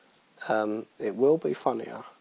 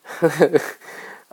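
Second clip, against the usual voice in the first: short chuckle at own boast; laugh; 4 semitones higher, 7 dB louder than usual. Real, not acted. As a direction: burst of self-amused laughter.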